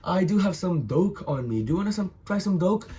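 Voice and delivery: high-pitched, put-on dealer voice; dealer's casual offer. Mental younger guy offering doke, high-pitched casual pitch. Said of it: "And he goes like".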